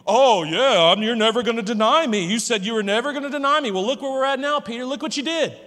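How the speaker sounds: loud, sneering taunt, fast in the middle; an imagined harsh God taunting Peter, jeering and loud; mocking taunt. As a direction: rub his failure in his face. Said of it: "Mocking him".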